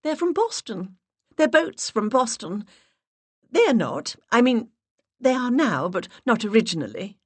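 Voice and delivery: sweet high-pitched put-on voice. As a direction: push the voice way up and play the charming visitor. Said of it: "raised my voice an octave".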